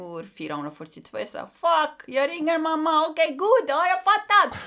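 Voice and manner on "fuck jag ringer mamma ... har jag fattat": much higher, louder, laughing surrender; excited giving in. Retold or imitated. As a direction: got the message, okay okay. Getting the sign, giving in. (upprymd, skrattande, mycket högre)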